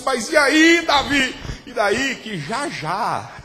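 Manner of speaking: loud, high, shouting preacher; fiery pulpit preaching; preaching at full force. Acted. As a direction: drive it home like a revival preacher. Preaching climax.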